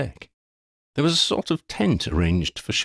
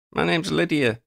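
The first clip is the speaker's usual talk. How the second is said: higher-pitched self-introduction; announcing; 10 semitones higher than usual. A narrator's reading. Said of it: "Lydia announced herself".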